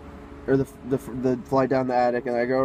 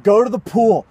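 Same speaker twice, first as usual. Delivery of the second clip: raised, higher, urgent command; urgent retold order; 6 semitones higher, 5 dB louder than usual. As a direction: bark a hurried order. Urgent order while fleeing the house.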